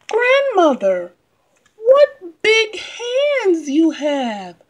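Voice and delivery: put-on high, slow, wondering girl; curious little girl. Girl questions the wolf.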